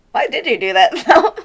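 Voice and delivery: laughing through a mock complaint; half-laughing exasperation. Friend mocking her own food-order reaction, laughing. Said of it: "my reaction's like".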